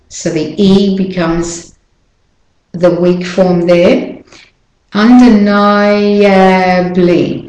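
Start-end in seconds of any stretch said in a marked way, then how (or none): 4.9-7.5 "Undeniably" very slow and drawn out, I and A apart; separated vowels. Shown as a demonstration. Teacher shows two separate vowels, very slow "Undeniably". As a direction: stretch "Undeniably" as un-de-NIGH-a-blee. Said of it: "you have to do the I and the A".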